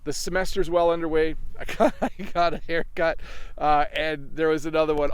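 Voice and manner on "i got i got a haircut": laughing. Real, not acted.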